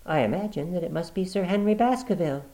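high, light woman's reply. Acted. Sister covering with her brother, smoothly. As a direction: answer smoothly.

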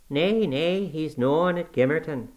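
put-on a dour old servant in broad dialect; old Yorkshire servant. A narrator's reading. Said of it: "said Joseph".

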